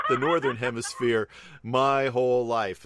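laughing through the words; real laugh spilling into the line. Real, not acted. Laughing at slip.

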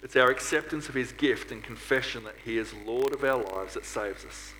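normal talking voice

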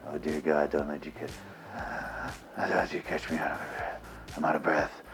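mock whispering action hero, low and hushed; Steven Seagal. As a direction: murmur every line softly and low, as if short of breath. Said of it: "he's gotta whisper every line of dialogue".

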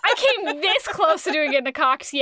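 real giggles breaking up the words; laughing. Real, not acted. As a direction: barely get the words out for laughing. Cracking up at own near impression.

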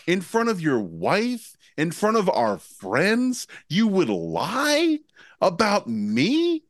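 high, hammed-up outraged innocence; mock indignant accusation. Accusing Will in front of his wife, mock outrage. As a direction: act wounded and accuse him. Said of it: "I'm like".

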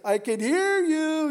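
high, loud, slow call; calling across water. Acted. Someone shouting across the lake, drawn out and loud.

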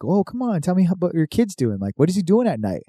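pry it out of him with a lifted voice; lightly voiced Santa coaxing an elf.

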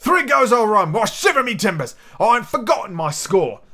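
put-on high, exclaiming old sea dog; sailor's outburst. Sea cook realises he forgot to collect, bursting out. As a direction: burst out in salty seafarer's indignation. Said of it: "he burst out".

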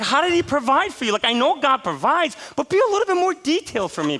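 high, loud, laughing plea; half-laughing mock plea for details; amused pleading. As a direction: beg for details through a laugh. Retold plea to other pastor.

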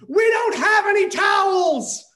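high, loud, slow desperate scream; top-of-lungs scream. Retold or imitated. Narrator screams at nobody about towels, full loud scream. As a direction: howl it to the empty house. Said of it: "screamed to no one in particular at the top of my lungs".

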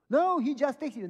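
high, loud acted objection; loud protest. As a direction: blurt it out as the upset lady. Woman protesting, retold.

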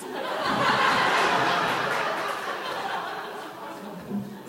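breathy relieved laughter; real laugh. Real, not acted. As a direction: laugh at the punchline. Laughs after story punchline.

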